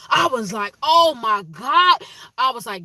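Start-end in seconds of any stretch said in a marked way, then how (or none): 0.8-2.0 loud drawn-out amazement. Retold or imitated. Seeing fiance's photos.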